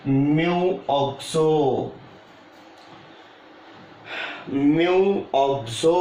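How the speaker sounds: drawn-out, syllable by syllable; slow dictation. Real, not acted. Teacher dictating a ligand name while writing, slow dictation. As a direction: stretch each word for note-takers.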